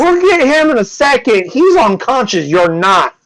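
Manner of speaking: high, loud guard retort; insistent guard. Acted. Guard to the suspect he is cuffing, insistent. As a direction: cut off the objection loudly.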